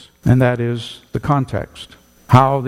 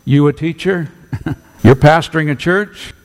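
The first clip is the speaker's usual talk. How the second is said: scoffing; 5 semitones higher, 4 dB louder than usual. Retold or imitated. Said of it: "It's not".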